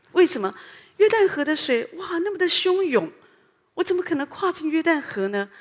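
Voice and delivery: high, loud mock protest of disbelief; alarmed doubter. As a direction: act out someone balking at a terrifying river. Acts out doubter facing the raging river.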